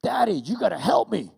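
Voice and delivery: put-on high, very loud child's plea; voiced little girl hollering; imitated demanding daughter. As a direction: demand Dad's help like a kid. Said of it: "she actually said".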